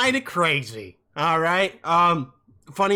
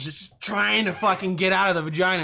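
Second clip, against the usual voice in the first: ordinary delivery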